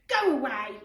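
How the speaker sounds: put-on bossy childish go away; shooing. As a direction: chase her off. George shooing Rashida.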